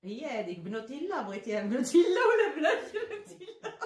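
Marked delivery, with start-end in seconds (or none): 1.2-3.8 laughing. Real, not acted. Laughing joke question.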